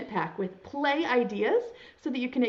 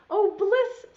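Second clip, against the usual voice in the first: high, loud rising sigh of delight; blissful; 9 semitones higher, 5 dB louder than usual. Acted. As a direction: melt into the warm bath. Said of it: "says the duck".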